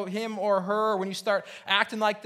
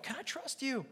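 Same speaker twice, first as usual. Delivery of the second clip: soft, higher-pitched doubting question; hushed questioning; 5 semitones higher, 12 dB quieter than usual. Acted. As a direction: drop to a small, wary voice. Voices a wary inner question.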